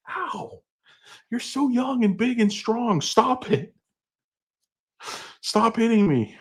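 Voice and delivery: high, pained falsetto protest; whining victim. Acted. Sibling begging brother to stop hitting, high mock whine. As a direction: plead in a high put-on whine. Acting out being hit by brother.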